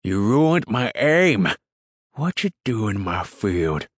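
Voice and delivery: angry rural roar, drawn out and loud. A narrator's reading. Farmer to intruder who ruined his aim, roared. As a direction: roar it at a trespasser who spoiled things. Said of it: "and roared".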